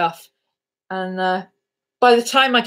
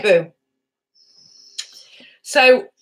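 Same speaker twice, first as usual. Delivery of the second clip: ordinary talk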